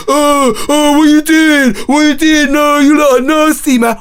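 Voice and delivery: shocked disgusted onlooker; mocking prude. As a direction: play a scandalised passerby filming. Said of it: "started filming them going".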